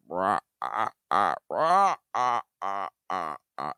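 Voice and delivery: rasping rah ah ah rising then fading; the noise that kept him awake; grinding groan dying out; imitated weird noise. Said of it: "All I hear is".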